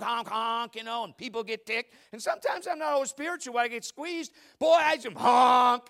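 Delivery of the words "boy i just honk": sudden loud slow blast; honking back. Retold or imitated. Preacher retelling road rage, loud slow blast. Honking at drivers who squeeze him.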